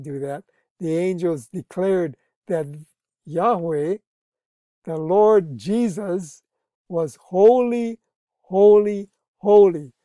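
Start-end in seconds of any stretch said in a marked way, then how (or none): 4.8-9.9 each holy louder, slow and drawn out; emphatic triple holy. Shown as a demonstration. Preacher stressing holy three times to the church, loud and building. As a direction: build the three holys as a proclamation. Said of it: "spoken three times for emphasis".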